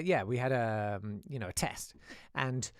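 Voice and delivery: usual speaking voice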